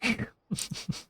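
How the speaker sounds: ordinary talk, nothing marked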